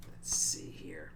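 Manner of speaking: low, near-whispered aside; muttering to self. Real, not acted. Reviewer looking for their place, muttering softly. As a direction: think aloud under your breath.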